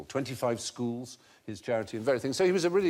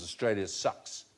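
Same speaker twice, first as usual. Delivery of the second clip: New Zealand accent; 4 semitones lower, 6 dB quieter than usual. Shown as a demonstration. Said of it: "they would pronounce as".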